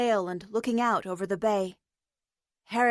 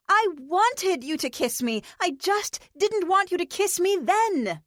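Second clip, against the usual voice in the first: exasperated; 7 semitones higher than usual. Acted. April exasperated, explaining.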